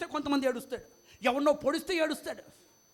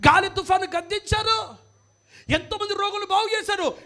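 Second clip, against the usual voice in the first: call-and-response chant, building higher and louder; rallying preaching; 4 semitones higher, 6 dB louder than usual. Acted. Preacher has the congregation repeat Jesus's miracles, rallying preaching. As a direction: drive each miracle home with a rising shout.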